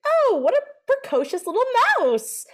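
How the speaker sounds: high, gushing fake delight; mock actor gushing. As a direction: overplay a clueless actor cooing. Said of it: "looking at nothing and being like".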